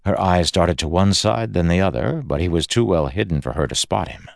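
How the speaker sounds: ordinary delivery, nothing marked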